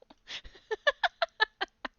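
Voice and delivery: high, loud burst of laughter; big laugh. Real, not acted. GM laughing at reading in a Texan accent, loud laugh. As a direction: crack up helplessly.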